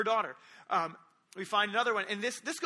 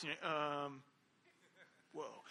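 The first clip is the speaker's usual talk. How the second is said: hushed awe; 7 semitones lower, 11 dB quieter than usual. Retold or imitated. Awe as storm stops.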